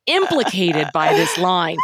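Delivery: ordinary delivery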